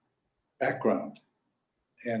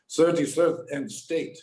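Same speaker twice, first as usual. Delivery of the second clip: imitated thick German accent, raised pitch; heavy German accent; 4 semitones higher than usual. Grandfather giving the cabbie an address, heavy German accent. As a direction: put on a strong German accent. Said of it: "says with a heavy German accent".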